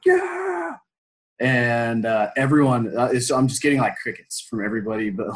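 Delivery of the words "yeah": huge high triumphant yell; Braveheart yell. Retold or imitated. Holding the duck head up to the team, a Braveheart roar. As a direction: roar like a victorious warrior. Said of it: "total Braveheart moment".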